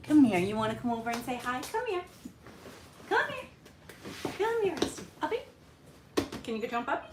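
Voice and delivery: very high coaxing call to the dog; calling the puppy. Real, not acted. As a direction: coax the puppy over in a squeaky high voice.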